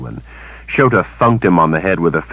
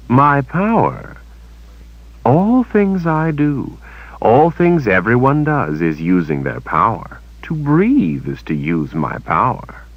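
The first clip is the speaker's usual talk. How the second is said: lightly voiced, measured defiance; Kalen, deliberate; 8 semitones higher than usual. A narrator's reading. Kalen's voice, slow.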